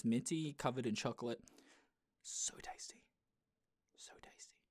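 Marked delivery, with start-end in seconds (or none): 2.2-4.6 soft breathy whisper, savoring it. Real, not acted. Savoring the smell of mint biscuits, whispered. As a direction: whisper it like a treat you crave.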